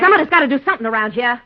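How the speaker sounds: fast, loud, fed-up burst; a sister snapping fast and loud at her brother; exasperated outburst. Acted. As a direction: blurt it out all at once in frustration. Sister overrides her brother's objection.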